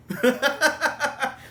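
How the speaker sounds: a burst of high laughter. Real, not acted. Laughing at Doctor.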